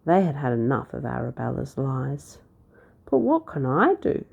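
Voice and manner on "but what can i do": higher, pleading cry; cried out. A narrator's reading. Prince pleading with the town folk, a mild cry. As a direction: throw up your hands helplessly. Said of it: "cried Tom".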